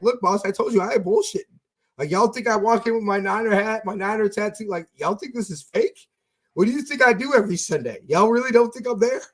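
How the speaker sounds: heated mock protest to boss; indignant insistence. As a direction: convince the doubting boss.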